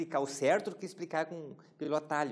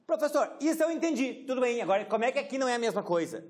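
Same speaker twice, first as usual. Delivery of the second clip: much higher, louder student voice asking; imitated student; 9 semitones higher, 6 dB louder, faster than usual. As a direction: play a puzzled student asking the teacher. Teacher voices a student question. (aluno curioso, voz bem mais aguda e alta)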